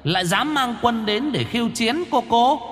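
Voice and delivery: put-on high, soft eerie child; menacing little demon child; creepy child voice. Sinister child demon speaks.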